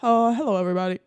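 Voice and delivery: mic check over the intercom; lightly voiced pilot testing mic. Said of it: "do you have an attendant voice, like a pilot voice".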